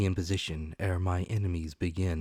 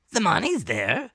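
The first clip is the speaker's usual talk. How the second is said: pirate shout; 12 semitones higher, 7 dB louder than usual. Acted.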